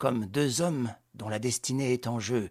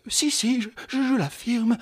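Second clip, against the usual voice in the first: pleading wife voice; 11 semitones higher, 5 dB louder than usual. A narrator's reading. Wife stammering her insistence.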